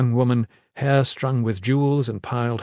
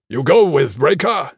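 loud, forceful command; firm order; 3 semitones higher, 7 dB louder than usual. A narrator's reading. Iron Fist orders his man to go with Breaker, firm order. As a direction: give the order with raised volume.

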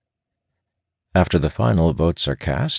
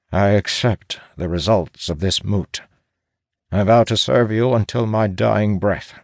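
mildly raised, solemn vow; pained, resigned; 5 semitones higher than usual. A narrator's reading. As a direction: swear loyalty with a touch of pain. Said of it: "says in a pained yet resigned tone".

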